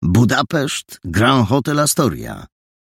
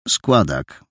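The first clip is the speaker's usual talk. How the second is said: lower, flat one-word answer; curt painter; 4 semitones lower than usual. Acted. Painter answers the boy curtly, lower. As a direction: cut the question short, lower.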